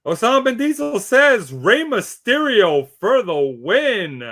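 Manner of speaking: loud, swooping announcer reveal; high, hyped pick announcement; announcer read. Acted. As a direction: boom it out like a ring announcer.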